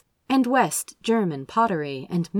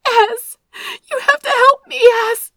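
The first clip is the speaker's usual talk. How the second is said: high, loud, tearful plea; desperate crying plea; 11 dB louder than usual. A narrator's reading. Sister on phone begs brother, crying. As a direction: voice cracking, barely holding together. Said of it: "a tone he'd only heard before".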